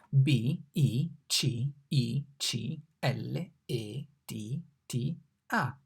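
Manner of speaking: B I C I C L E T T A spelled slowly; teacher spelling slowly. Shown as a demonstration. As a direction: spell it letter by letter. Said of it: "Come si scrive bicicletta?".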